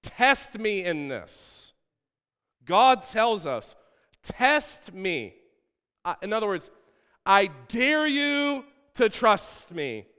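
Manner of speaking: high, emphatic scripture proclamation; full-force preaching. Acted. Preacher delivers God's dare to the church, forceful proclamation.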